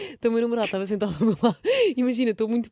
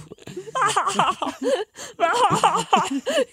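high uncontrollable laugh near tears; real fit of laughter; 12 semitones higher, 4 dB louder than usual. Real, not acted.

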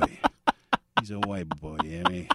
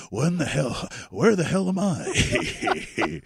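put-on higher, slow, dazed old man; confused old man; 8 semitones higher, much slower than usual. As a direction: wake up lost like a doddering old star. Impersonating dying Groucho.

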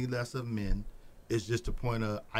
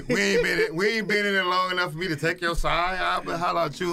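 mock scolding; 7 semitones higher, 6 dB louder than usual. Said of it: "I was like".

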